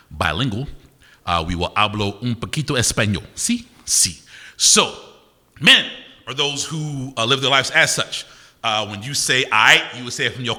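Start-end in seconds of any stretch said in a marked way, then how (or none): none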